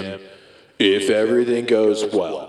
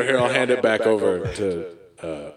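ordinary talk

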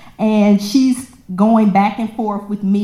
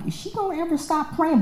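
inner exasperation; 8 semitones higher, 8 dB quieter, faster than usual. Retold or imitated. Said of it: "back then, I'm like".

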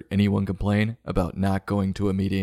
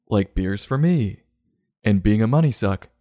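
usual speaking voice

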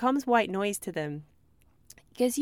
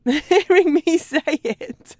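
laughing through the words; amused laugh at her own babble; 7 semitones higher, 9 dB louder, much slower than usual. Real, not acted. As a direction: break into real laughter.

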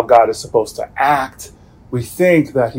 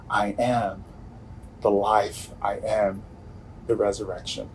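Jesus speaking softly; 4 semitones lower, 14 dB quieter than usual. Retold or imitated. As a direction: say it quietly, like comfort in grief. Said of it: "Jesus stands ... he says".

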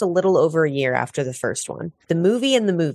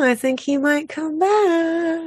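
high, slow voice; 13 semitones higher, slower than usual. Pretend.

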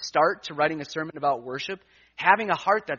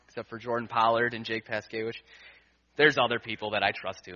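ordinary talk

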